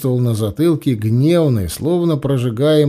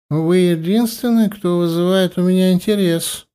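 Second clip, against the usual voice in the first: tipsy, babbling a little; a touch of drunken slur; slightly slurred; 7 semitones higher than usual. Acted. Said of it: "пролепетала она слегка заплетающимся языком".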